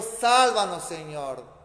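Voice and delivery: usual speaking voice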